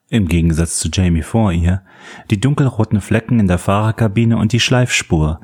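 plain reading voice

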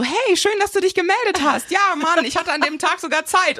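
chirpy high voice. Retold or imitated. Ex's cheerful reply months later.

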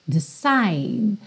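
"Decide" ending on a clear D; final D. Shown as a demonstration. A teacher showing the final D of "Decide". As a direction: close "Decide" on the D with no E. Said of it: "o D já vem mesmo finalizando".

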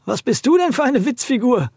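calling out. Acted. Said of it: "rief der Schmutzteufel".

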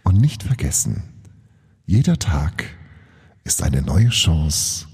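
measured announcer voice with deliberate pauses; show sign-off. Acted. Host closing with a Schiller quote, announcer sign-off. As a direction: deliver the motto phrase by phrase.

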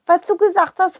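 ordinary delivery

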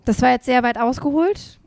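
ordinary talk

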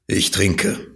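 dark, heavy reply; mildly gloomy drunkard. Acted. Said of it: "mit düsterer Miene". (düster, schwer)

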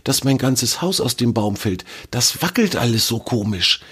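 high, anxious little squirrel voice; fretful squirrel asking a friend for advice; worried young squirrel. Acted. Squirrel Fridor asks for help.

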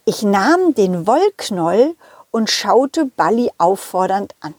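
plain reading voice

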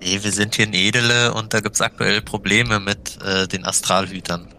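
ordinary talk, nothing marked

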